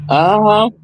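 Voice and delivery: ordinary talk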